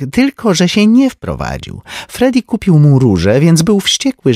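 high, lively society lady; a chatty rich woman gossiping; Tenzi's voice. A narrator's reading. (wysoki głos plotkującej damy)

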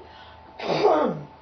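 loud throat-clearing rumble; frog's cough. Acted. Bullfrog announcing himself to Tubby, loud cough. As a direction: clear the throat with a big croaky noise. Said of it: "coughed the frog".

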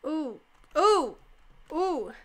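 ordinary talk, nothing marked

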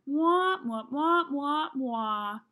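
mock adult-talk wah wah, loud then fading; Charlie Brown teacher wah. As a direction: do the muffled underwater wah wah, dying away. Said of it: "like someone is talking underwater".

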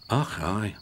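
low, soft Scottish mumble; half asleep Scot grumbles; sleepy Scottish mutter. Acted. Donald mutters sleepily at Duck in a Scottish voice. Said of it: "muttered Donald sleepily".

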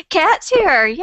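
loud, high welcoming cheer; excited cheer. Real, not acted. Hosts greet the late co-host as she appears, excited cheer.

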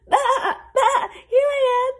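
very high, loud bleating shouts; desperate lamb crying out; frantic lamb. A narrator's reading. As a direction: bleat and call to be found. Lamb cries out to shepherd.

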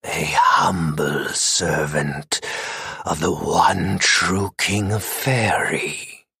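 low, slow, cooing purr. A narrator's reading. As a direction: coo it silkily from the dark. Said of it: "cooed the voice".

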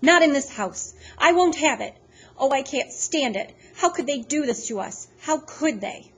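loud, high distressed protest; grandma crying out against it; upset grandma. Acted. Upset grandma protesting to Grim, loud and high.

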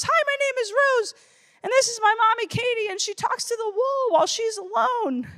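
high-pitched imitation of a small daughter; little girl voice. Daughter presenting her mom at career day, high imitated child voice. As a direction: chirp it like a child introducing mommy. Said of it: "And she goes".

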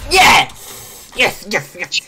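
loud, high victory cheer; triumphant yell. Real, not acted. Gamer celebrates a kill, triumphant shouting. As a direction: shout it out, easing off on the repeats.

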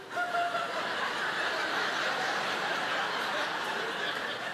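high breathless burst of laughter; disbelieving laugh. Real, not acted. Shocked laughter at reveal.

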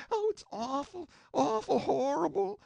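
high, softly voiced wail of horror; mock wailing in a high, thin cry. A narrator's reading. Shocked courtroom crowd crying out at the news, wailing. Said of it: "wailing and crying and saying".

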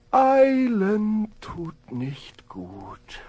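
very slow, drawn-out words; a slow snail giving its moral; snail's saying. Acted. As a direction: say it slowly like a snail. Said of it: "und sagte".

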